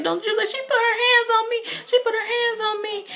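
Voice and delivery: high, wailing mock sob; imitated crying. Angry woman crying in the bathroom, imitated high tearful wail. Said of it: "she in the bathroom crying".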